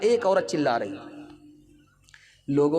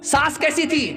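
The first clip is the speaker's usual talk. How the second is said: eager questioning women; 8 semitones higher than usual. Acted. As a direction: sing it out like curious aunts. Women of house ask.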